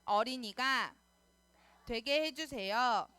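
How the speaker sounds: slow chanted prayer in short phrases. Real, not acted. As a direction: lead the prayer phrase by phrase, stretching each ending. Leads children in repeat-after-me prayer.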